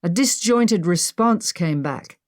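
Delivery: ordinary talk, nothing marked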